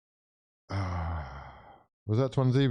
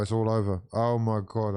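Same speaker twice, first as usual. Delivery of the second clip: usual speaking voice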